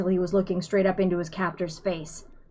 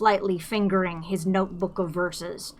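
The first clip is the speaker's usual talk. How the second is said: plain reading voice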